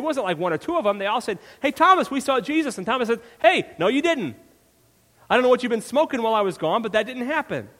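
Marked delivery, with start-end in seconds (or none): 1.6-2.8 excited. Acted. Disciples excitedly telling Thomas. 3.4-7.7 scoffing disbelief. Acted. Thomas scoffing at the disciples.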